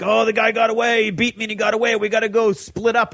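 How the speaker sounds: high, hammed-up panic of a liar; faked alarm. Shane lies to the group, hammed-up fake panic. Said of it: "he's like".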